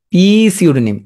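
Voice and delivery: পি সিউডোনিম with the silent P said as a long "pee"; silent P voiced. Shown as a demonstration. Teacher giving a spelling trick, silent P sounded before সিউডোনিম. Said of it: "বলতে হবে পি সিউডোনিম".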